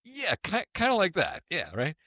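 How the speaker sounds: high, sheepish grin in the voice; sheepish smiling admission. Retold or imitated. Bruce owning up to the limo driver, sheepish grin. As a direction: own it with a shy smile. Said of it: "kinda smiles and goes".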